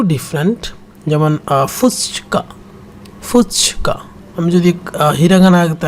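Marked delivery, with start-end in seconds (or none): 1.7-4.0 whispered demo of the word fuchka. Shown as a demonstration.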